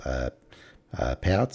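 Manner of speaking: ordinary talk, nothing marked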